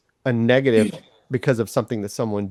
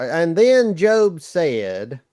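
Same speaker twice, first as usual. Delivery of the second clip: slow, high, exaggerated Southern drawl; Southern vernacular; 6 semitones higher, much slower than usual. Shown as a demonstration. Co-host shows off reading scripture, in a Southern vernacular. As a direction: read it in a drawn-out Southern accent. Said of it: "I'll read it in my Southern vernacular".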